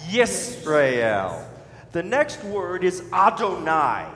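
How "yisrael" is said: Yisrael drawn into yis-ra-el; three-syllable Yisrael. Shown as a demonstration. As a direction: lead learners through Yisrael, syllable by syllable. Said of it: "try that".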